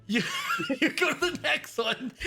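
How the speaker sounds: laughing. Real, not acted. GM laughs at the kill.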